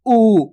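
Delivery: उ held as a firm "ooo"; stressed long vowel. Shown as a demonstration. Teacher reads the tailed letter for pupils, forceful long उ. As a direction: lean hard on उ and stretch it. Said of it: "इसको जोर लगा के पढ़ेंगे".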